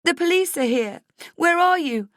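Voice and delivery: high, loud, upset voice; frantic friend on the phone; distraught. Acted. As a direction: call it out high and tearful.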